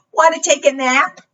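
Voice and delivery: high, quick inviting question; storybook cat. Acted. Reader voices the cat's question.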